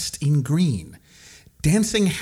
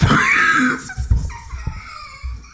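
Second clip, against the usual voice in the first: squealing burst of laughter; high shrieking laugh. Real, not acted. Breaks up mid-story.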